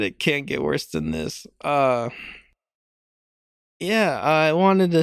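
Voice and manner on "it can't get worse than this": much lower and softer, deadpan; deflated mutter. Shown as a demonstration. Host admitting to listeners it can't get worse, flat and low. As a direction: let it sink in, defeated. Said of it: "Holy shit".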